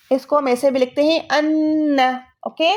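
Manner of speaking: ordinary talk, nothing marked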